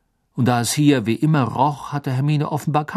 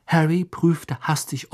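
ordinary delivery, nothing marked

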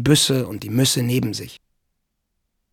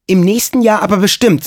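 high, loud, insistent demand; relentless; 7 semitones higher, 7 dB louder than usual. A narrator's reading. Maid presses farmhand to marry her, relentless. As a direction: press it without giving an inch. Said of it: "sagte Lina unerbittlich".

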